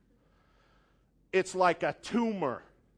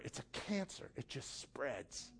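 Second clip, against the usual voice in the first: hushed, much softer warning; whisper; 5 semitones lower, 15 dB quieter than usual. Real, not acted. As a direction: drop to a secret whisper. Sermon point, dropped to hush.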